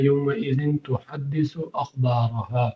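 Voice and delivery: slow, soft recited "یومئذ تحدث اخبارها"; نرمی سے تلاوت; Quran recitation. Shown as a demonstration. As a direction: intone the verse quietly, sounds drawn out. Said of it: "اخبارها اس کے آگے آ رہا ہے".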